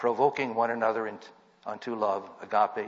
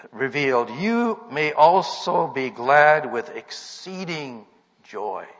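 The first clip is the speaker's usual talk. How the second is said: louder, higher pulpit proclamation; emphatic preaching; 5 semitones higher, 9 dB louder than usual. Acted. Preacher urging believers to rejoice through trials, emphatic preaching. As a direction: preach it out, driving the key words. Preaches scripture with rising force.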